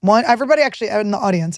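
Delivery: normal talking voice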